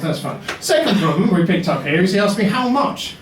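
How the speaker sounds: ordinary talk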